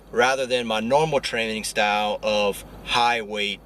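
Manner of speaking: ordinary talk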